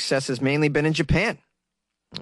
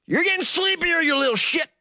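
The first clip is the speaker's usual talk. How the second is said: much higher, strained hypnotist outburst; impatient outburst. Acted. Sheriff losing patience with the suspect he hypnotizes, high-pitched exasperation. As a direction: lose patience, pitch shooting up.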